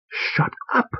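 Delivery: harsh whispered snap; hissed shut up. A narrator's reading. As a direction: hiss it through the teeth. Husband snapping at wife.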